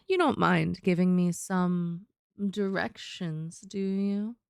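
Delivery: low, slow and husky, coaxing a stranger. Shown as a demonstration. Coaxing a battle-hardened stranger, low and husky. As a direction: drop into a deep husky purr. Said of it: "this voice comes out, and it's gonna get deeper and huskier".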